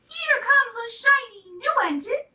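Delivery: high, loud cheerful call; a funny little clown spotting help; excited clown voice. A narrator's reading. Clown shouts to the toys, excited. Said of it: "said the funny little clown".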